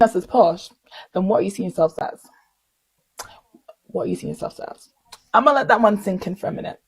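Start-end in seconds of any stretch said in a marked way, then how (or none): 3.8-4.9 near-whispered rhetorical question; hushed repeat. Real, not acted. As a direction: repeat it under your breath, very softly. Question repeated under her breath.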